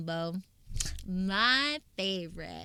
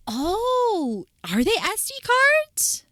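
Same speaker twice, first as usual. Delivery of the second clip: surprised; 11 semitones higher, 10 dB louder, much slower than usual. Real, not acted. Surprised at card holder.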